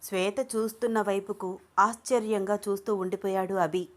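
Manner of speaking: plain reading voice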